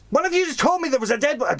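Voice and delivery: fast, high, exasperated boss; high-pitched impatient questioning; acted boss accusing. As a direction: snap back quickly at the henchmen. Acted boss arguing with guards.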